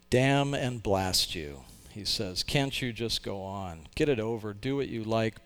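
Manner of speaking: ordinary talk, nothing marked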